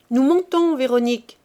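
loud and high, calling across the house. A narrator's reading. Madame Chanteau calling to the maid in the kitchen: loud high call. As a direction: shout it up to the kitchen. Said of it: "cria-t-elle".

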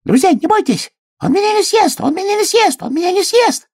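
very high squeaky calls; calling hedgehog. A narrator's reading. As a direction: call out high, repeating urgently. Hedgehog shouts while being carried off. (тонкий отчаянный крик ёжика)

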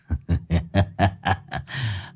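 quiet contemptuous laugh; a cold boss's snicker; soft sneering chuckle. Acted. Boss sneers.

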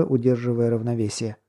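ordinary delivery, nothing marked

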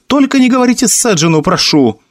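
high, hurried plea; pleading cry. A narrator's reading. Alistair begs the officer not to tell Sedzhin, crying out in a rush. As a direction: beg urgently, pitch jumping up. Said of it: "вскричал Алистер".

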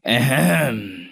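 long, drawn-out ahem; throat clearing. A narrator's reading. Master pauses before his verdict, drawn-out ahem. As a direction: clear your throat ponderously before speaking. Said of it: "Mãi một lúc sau thầy mới nói".

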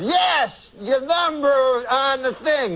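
high Cosby voice; Bill Cosby impression. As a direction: pitch it up like Cosby. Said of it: "It's getting there, my Cosby".

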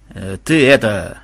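low, slow, hesitant mutter; gruff mumble. A narrator's reading. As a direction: mumble it awkwardly. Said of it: "буркнул Махмуд". (буркнуть низко, с запинкой)

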